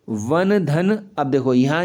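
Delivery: ordinary talk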